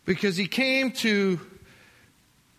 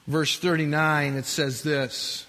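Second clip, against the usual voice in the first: ordinary delivery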